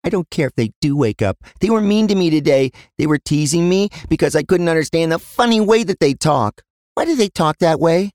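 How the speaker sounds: put-on high-pitched child's voice, put out; little girl protesting, pitched far up; young daughter, complaining. Daughter complaining to dad about the twins, high indignant child voice.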